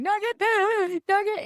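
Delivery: high mock yell for the lost dog; retold calling out. Searching the neighborhood, mock-calling her lost dog high. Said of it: "'cause I'm screaming".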